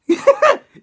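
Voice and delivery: sudden high, loud burst of laughter; shrieking laugh. Real, not acted. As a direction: explode into a squealing laugh. Bursts out at the neck lyric.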